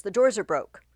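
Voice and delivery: loud alarm; alarmed king. Acted. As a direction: shout the doors gave way.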